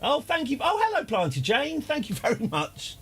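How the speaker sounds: light up at the surprise gift; delighted thanks. Real, not acted. Thanking Planty Jane for the gift, delighted.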